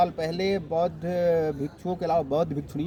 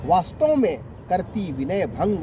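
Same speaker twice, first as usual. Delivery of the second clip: rhythmic, emphatic rhymed verse; poem recitation; 3 semitones higher than usual. Acted. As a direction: recite couplets to an appreciative audience. Recites satirical poem for listeners. (जोश और लय के साथ कविता पाठ)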